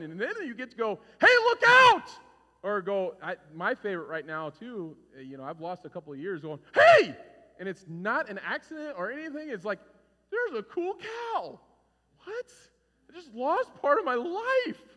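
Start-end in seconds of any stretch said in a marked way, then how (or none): 1.2-2.1 sudden loud warning yell; startled passenger shouting a warning. Pretend. 6.7-7.1 sharp loud startled yell. Pretend. 10.3-14.8 high, slow goofy voice; dopey passenger marveling at a cow; mock dopey passenger.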